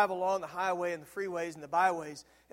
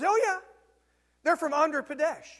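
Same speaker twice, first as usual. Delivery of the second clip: higher, louder breezy friend answering; voiced friend; 8 semitones higher, 7 dB louder than usual. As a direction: toss the answer off like it's obvious. Voicing Andrew's casual answer.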